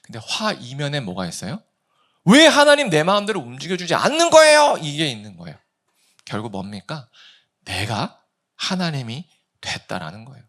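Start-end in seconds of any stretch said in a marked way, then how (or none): 2.2-4.8 high, loud outraged complaint; angry protest. Acted. Preacher voicing believer's hidden demand to God, angry protest. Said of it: "그냥 화가 날 뿐이에요". 7.7-10.4 measured, with pauses between phrases; deliberate statement. Shown as a demonstration. Preacher revealing the conclusion to congregation, deliberate phrasing.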